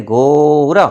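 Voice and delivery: slow, loud घोरा, stretched with R for ḍ; pupils copying teacher. Acted. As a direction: parrot the long घोरा back. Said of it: "हम कहते". (बच्चों की नकल, खींचकर ज़ोर से घोरा)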